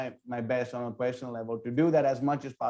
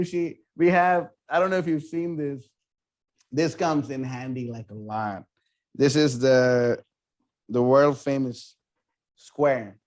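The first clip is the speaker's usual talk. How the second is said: ordinary delivery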